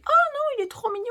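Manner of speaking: very high, loud mock squeal; hammed-up high-pitched adoration; imitated cooing. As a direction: act out melting over something cute. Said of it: "je me dis".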